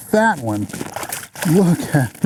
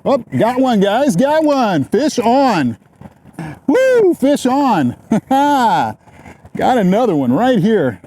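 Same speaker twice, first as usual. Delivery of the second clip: excited; 8 semitones higher than usual. Real, not acted. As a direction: shout it out as the fish bites. Hooked a fish, shouting.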